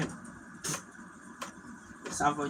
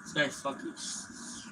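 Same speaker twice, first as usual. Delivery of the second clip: soft, slow half-whisper; muttered under breath; 13 dB quieter than usual. Real, not acted. Gamer muttering about a bad teammate, hushed. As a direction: grumble it to yourself.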